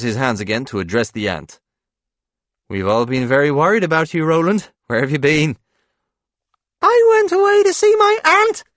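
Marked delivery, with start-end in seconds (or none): 2.7-5.6 louder, higher play-acted worry; exaggerated parental fretting; mock concern. A narrator's reading. Father addresses the pretend ant. 6.8-8.6 squeaky, loud pretend critter; very high puppet-like voice; high-pitched ant voice. A narrator's reading. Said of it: "says in a high-pitched voice".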